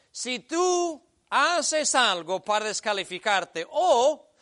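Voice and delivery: preaching at full force. Acted. Pastor warning listeners, loud preaching. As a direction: lean in and warn them hard.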